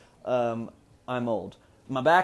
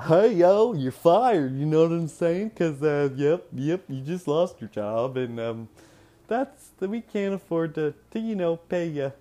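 higher, louder put-on Indiana voice; mock Indiana accent; 6 semitones higher, 6 dB louder than usual. Indiana boss firing an employee, mock local accent. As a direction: play a hammed-up Indiana boss. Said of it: "would have probably been more like".

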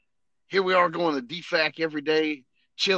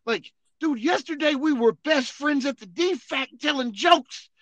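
incredulous; 11 semitones higher, 4 dB louder than usual. Retold or imitated. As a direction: plead in disbelief. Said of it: "And I was like".

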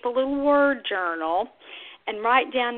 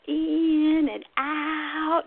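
"in and out" slow and high, both key words held; "in" and "out" drawn out in sing-song; exaggerated contrast; 7 semitones higher, much slower than usual. Shown as a demonstration. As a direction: elongate "in", then open wide on "out". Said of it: "really exaggerate that difference".

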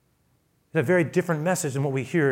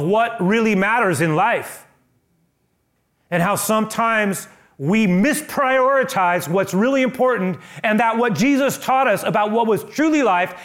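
high, loud, impassioned sermon build; fervent preacher climbing to a peak; preaching at full force; 8 semitones higher, 7 dB louder than usual. Acted. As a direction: drive it home from the pulpit, much higher and louder.

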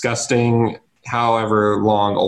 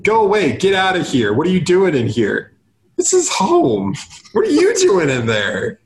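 lightly voiced Curtis, high and squeaky; a hint of a squeaky annoyed man; high mock scolding voice; 7 semitones higher than usual. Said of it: "Curtis is like".

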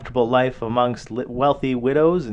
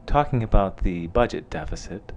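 lower, softer smooth radio DJ voice; lightly voiced late-night public radio host; NPR radio host; 4 semitones lower, 4 dB quieter than usual. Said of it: "one of those cool N P R D Js".

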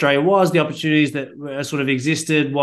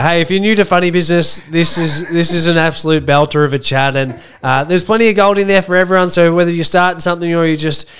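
louder, polished host pitch; professional host read; 2 semitones higher, 6 dB louder than usual. Acted. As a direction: sell the episode like a pro host. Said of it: "nice professional save there".

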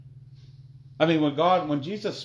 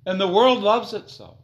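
higher, louder, slow fiery sermon; fiery preaching; 7 semitones higher, 8 dB louder, slower than usual. Acted. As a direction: thunder it at the congregation. Preaching at full force.

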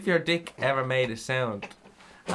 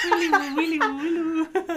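doorbell jingle impression. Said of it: "every time Dara has sex".

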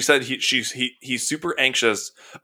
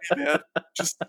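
imagined pleading dog; 3 semitones higher, 6 dB quieter than usual. Retold or imitated. Voicing the anxious dog for a co-host, mock pleading. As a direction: squeak it out softly as the worried dog. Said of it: "the added effect of him going".

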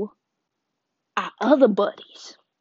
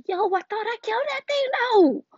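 shocked Arthur; 13 semitones higher than usual. Retold or imitated. Arthur shocked monster lives.

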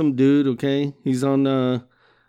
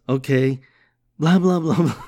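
ordinary talk, nothing marked

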